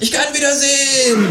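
very high cry of relief; screaming kangaroo. A narrator's reading. Kangaroo kissing the ground outside, screaming in relief. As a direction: cry out high, holding the last word.

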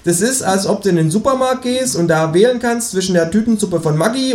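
kangaroo lecturing. Acted. The kangaroo mocking the election to the narrator, smug lecture.

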